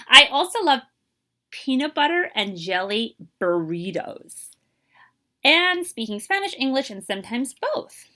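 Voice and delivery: lively, expressive picture-book reading; teacher reading a storybook to young kids; reading to children. Acted. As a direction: read it aloud to a class, bringing each line to life. Teacher reads picture book aloud.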